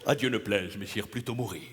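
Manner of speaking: low, solemn stage declamation; grand theatrical. Acted. Dramatist answering the lady with lofty solemnity. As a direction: swear it like a tragic hero.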